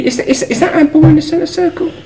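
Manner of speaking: high-pitched mock questioning; mock query. Podcaster mimicking a handball appeal at centre circle, high mock query.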